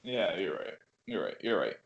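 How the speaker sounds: low, soft giving in; quiet concession. Real, not acted. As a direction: back down under your breath. Concedes after being corrected.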